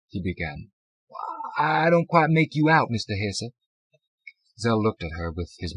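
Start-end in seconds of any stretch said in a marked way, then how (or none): 1.6-3.5 clerk voice. A narrator's reading.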